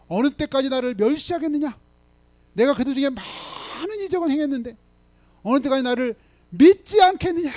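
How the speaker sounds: high, loud indignant rebuke; God scolding his people, high and loud; rebuking God's voice. Acted. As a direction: thunder out God's complaint.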